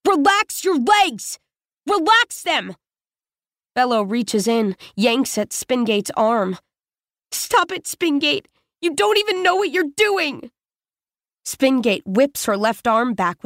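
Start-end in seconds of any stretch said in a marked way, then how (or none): 0.1-2.8 screaming. Acted. Spingate screaming at the wounded boy to relax, furious. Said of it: "screams in his face". 7.3-10.5 high, sharp protest; angry protest. Acted. Bello protesting Spingate's rough handling, angry.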